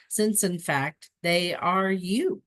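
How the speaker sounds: slowing down to stress each word; slow and emphatic. Shown as a demonstration. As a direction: lay it down slowly and firmly. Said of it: "slowed emphasis on the key point".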